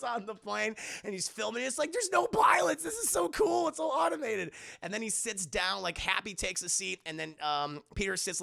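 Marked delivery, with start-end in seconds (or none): 1.9-4.5 high-pitched awed gushing; mock excited teen. Imitating Peter filming on the plane, high excited gush. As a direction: gush like a kid filming everything.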